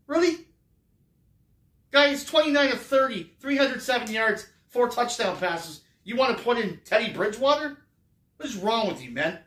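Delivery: high, loud disbelief at the fan; incredulous outrage. Acted. Answering the fan who wants Drew benched, high loud disbelief. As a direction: scoff at an absurd suggestion.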